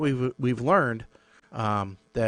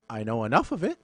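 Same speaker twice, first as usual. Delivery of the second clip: ordinary talk, nothing marked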